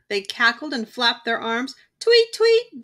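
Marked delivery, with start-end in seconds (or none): 2.0-2.8 high, loud chirping tweet; birdlike tweeting. A narrator's reading. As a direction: chirp it like a bird. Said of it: "they sang".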